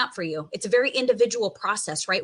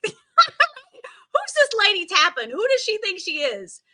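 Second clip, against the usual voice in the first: high-pitched, incredulous doubter; playful mock indignation, pitch swooping up; mock skeptic; 8 semitones higher than usual. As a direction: act out a scoffing student's disbelief. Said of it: "Maybe you think I'm crazy".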